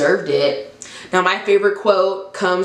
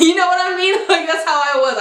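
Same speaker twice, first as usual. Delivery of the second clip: laughing; 11 semitones higher, faster than usual. Real, not acted. Laughing at herself.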